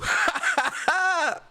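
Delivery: high mocking cackle; put-on high cackle. Shown as a demonstration. Streamer ends his soundbite with a high mock cackle.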